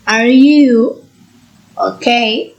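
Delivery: slow, broken-up learner's rising question; rising intonation. Shown as a demonstration. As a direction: question rising sharply on "you". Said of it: "the tone rises at the end".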